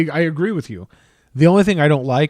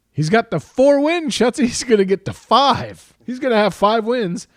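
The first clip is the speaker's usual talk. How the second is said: excited; 6 semitones higher than usual. Real, not acted. Hyping coach's wins.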